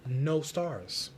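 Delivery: plain reading voice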